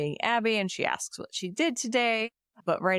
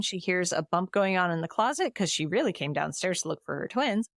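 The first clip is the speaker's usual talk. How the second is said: normal talking voice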